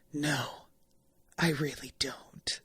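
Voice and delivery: low, flat, whispered admission; deadpan whisper. Retold or imitated. Usopp admits he has no better idea, a low deadpan whisper. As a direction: squint and say it dead-flat under your breath. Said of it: "pitch perfect line delivery".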